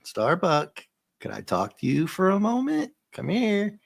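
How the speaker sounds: mock sweetly, needling him; facetious sing-song. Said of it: "He's being very facetious to him".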